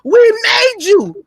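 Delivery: WE made you, slow, high and loud; outraged, slow and high. Retold or imitated. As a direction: lean hard on "We made you". America voiced, scolding.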